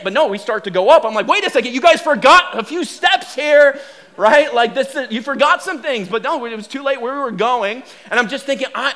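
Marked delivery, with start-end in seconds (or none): 1.3-6.1 loud, high-pitched objection; alarmed protest. Retold or imitated. Rider protesting to the crew that they forgot steps, loud alarmed protest. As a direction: call out to the ride crew in alarm. Acted protest as ride starts.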